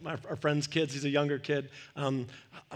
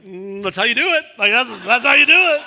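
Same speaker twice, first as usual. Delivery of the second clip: much louder, higher laughing exclaim; laughing shout; 6 semitones higher, 14 dB louder than usual. Retold or imitated. Pastor telling the parent it was legit, laughing loudly. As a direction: crack up and declare it with delight. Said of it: "And I'm like".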